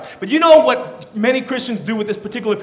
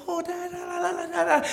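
high, soft singsong babbling; playful baby babble; 9 semitones higher, 9 dB quieter than usual. Retold or imitated. Dad mimicking his baby playing near the bed edge, high soft babble. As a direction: imitate a carefree baby babbling at play.

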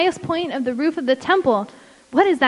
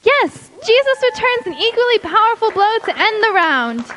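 excited commentator; 6 semitones higher, 3 dB louder than usual. Acted. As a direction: call the round winner to the crowd. Fight commentator calls the round.

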